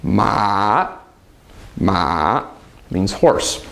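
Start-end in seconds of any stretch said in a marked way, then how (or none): 0.0-1.0 MA dipping low, then back up; drawn-out MA that falls and rises. Shown as a demonstration. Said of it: "tone that goes down and then back". 1.8-2.5 MA dropping low, then rising back; low scooped MA; dipping tone. Shown as a demonstration. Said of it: "tone that goes down and then back".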